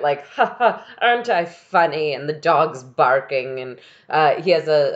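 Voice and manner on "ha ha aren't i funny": put-on ha-ha while imitating a smug performer; a light, fake laugh into the line; mock laughing. Podcaster mimicking pianist laughing through his playing, mock laughing. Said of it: "is laughing his way through it".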